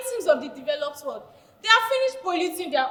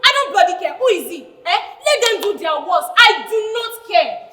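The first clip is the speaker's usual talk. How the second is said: louder, defiant I don't care; defiant anger; 6 dB louder than usual. Acted. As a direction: dare them to do their worst. Defiant, teammate going to report.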